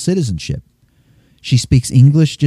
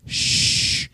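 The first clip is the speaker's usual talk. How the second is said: shushing; 8 dB quieter than usual. Retold or imitated. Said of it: "says in English to them".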